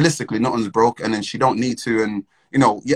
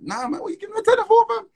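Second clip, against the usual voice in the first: much higher, quick squeaky alarm; mock panic at own hand; 3 dB louder than usual. Scolding his own hand as it gives the tenner, squeaky mock panic. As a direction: shriek at your own hand in mock horror. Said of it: "I was like".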